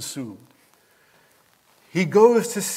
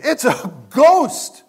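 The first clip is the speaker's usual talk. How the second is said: high, loud terrified cry of ghost; frightened cry; 10 semitones higher, 7 dB louder, slower than usual. Retold or imitated. Disciples shrieking in fear at a figure on water. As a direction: cry it out like a scared sailor seeing a ghost.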